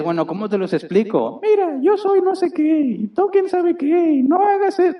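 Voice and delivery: hammed-up scolding of a stubborn child; exasperated parent. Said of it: "Y dices". (padre exasperado, grito exagerado mucho más agudo y fuerte)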